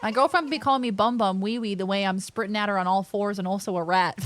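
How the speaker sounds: usual speaking voice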